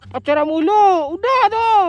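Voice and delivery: slow, drawn-out high yell; heckling shout. Real, not acted. Scolding a couple for always dating, shouting. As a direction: holler each word out long and loud.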